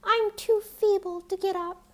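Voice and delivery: put-on high, frail old voice; weak old woman explaining from her bed; feeble grandmother. A narrator's reading.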